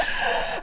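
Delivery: sharp unvoiced intake of breath; a mock scared gasp before the needle; frightened gasp. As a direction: gasp as if bracing for pain. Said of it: "And I'm like".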